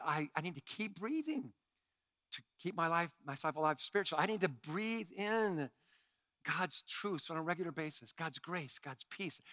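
soft devotional tone; hushed preaching. Acted. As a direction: draw them in quietly.

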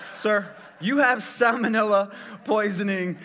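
mock doctor announcing salmonella; doctor's verdict. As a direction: deliver the diagnosis grandly. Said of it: "His words".

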